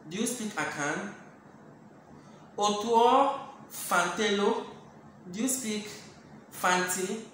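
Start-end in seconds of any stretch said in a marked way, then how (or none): none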